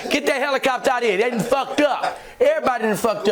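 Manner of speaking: high, laughing taunting; talking shit. Retold or imitated. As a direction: gloat at the cops, enjoying it. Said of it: "I'm talking shit now".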